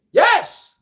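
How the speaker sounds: sudden high yelp of glee; high, gleeful shout of yes; excited cheer. Acted. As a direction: cheer on the kill like a hype fan. Cheering Mortal Kombat finishing move.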